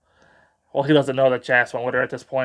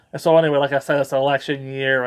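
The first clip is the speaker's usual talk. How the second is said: ordinary talk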